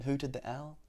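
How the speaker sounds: ordinary delivery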